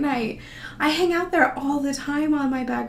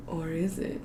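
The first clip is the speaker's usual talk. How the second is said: softer, lower ominous question; spooky hushed tease; 5 semitones lower, 8 dB quieter than usual. Acted. Teasing viewers about the tattoo, hushed and ominous. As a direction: tease them with a creepy hush.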